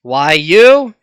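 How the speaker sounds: loud outburst at an opponent; indignant shout. Acted. Kendo rushing at the assailant, indignant shout. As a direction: shout it as if provoked. Challenger snaps in acted fight.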